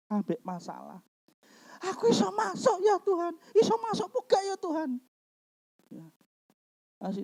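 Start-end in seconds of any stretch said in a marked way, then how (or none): 1.8-4.9 very high, whining mock sob; mock tearful plea. Doubting believer asking God to get in, mock tearful whine. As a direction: wail it high like a weepy believer.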